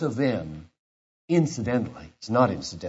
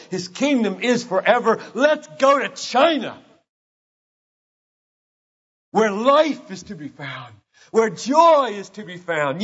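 high, loud, rising sermon climax; impassioned preaching; 10 semitones higher, 5 dB louder than usual. Real, not acted. Preacher rousing the congregation, impassioned and loud. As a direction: preach it with full fire.

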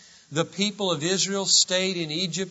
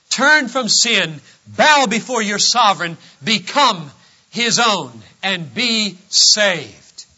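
forceful, emphatic proclamation; full-force preaching; 3 semitones higher, 5 dB louder than usual. Real, not acted. Preacher calling people to bow to their sovereign, forceful preaching. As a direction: drive each phrase home to the congregation.

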